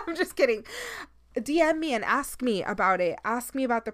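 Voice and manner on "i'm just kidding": said through a laugh, higher. Real, not acted. Taking back her joke to listeners, laughing. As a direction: giggle the words out.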